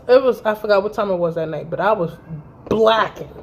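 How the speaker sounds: ordinary talk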